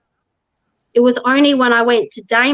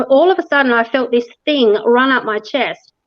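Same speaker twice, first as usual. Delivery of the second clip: ordinary talk, nothing marked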